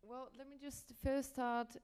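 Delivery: usual speaking voice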